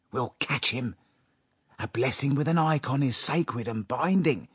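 conspiring with his wife, quivering with impatience; impatient. A narrator's reading. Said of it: "quivering with impatience".

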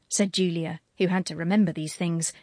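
plain reading voice

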